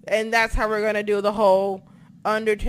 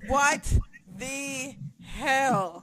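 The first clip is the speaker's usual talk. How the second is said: drawn-out disbelief; 2 semitones higher, much slower than usual. Real, not acted. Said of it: "I'm going to scream very loud".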